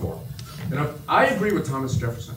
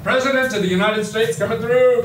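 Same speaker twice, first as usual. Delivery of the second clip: calling out; 8 semitones higher, 5 dB louder than usual. Real, not acted. Clearing a path through a crowd.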